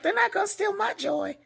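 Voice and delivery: high, loud and proudly unbothered; defiant godmother. Retold or imitated. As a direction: toss it off with pride.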